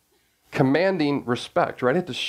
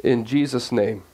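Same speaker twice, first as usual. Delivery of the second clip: low, unhurried prayer voice; 3 semitones lower than usual. Real, not acted. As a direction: reverent opening prayer. Opening prayer before sermon.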